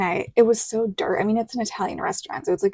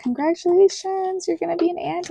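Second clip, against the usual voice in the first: cheery well-wisher; 9 semitones higher than usual. Retold or imitated. Guests congratulating her.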